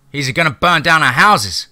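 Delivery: high, loud alarmed shout; a freed slave shouting from the back of the crowd. A narrator's reading. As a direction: yell the warning out high and loud. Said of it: "someone shouted from the back".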